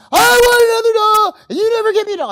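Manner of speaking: mock tantrum of a child at Toys R Us; screaming child. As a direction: bawl it out like a toddler denied a toy in the store.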